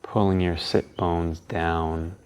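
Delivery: calm, unhurried guiding tone; guided meditation. Acted.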